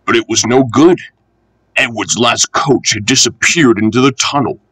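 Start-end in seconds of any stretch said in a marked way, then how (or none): none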